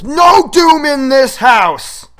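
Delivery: high, slow, shouted ban; sister's shout. Retold or imitated. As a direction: bellow the house rule like a bossy older sister. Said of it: "my sister looks at me and goes".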